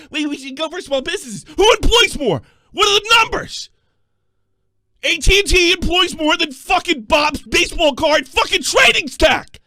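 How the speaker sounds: host yelling after hanging up; angry rant. Real, not acted. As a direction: tear into him.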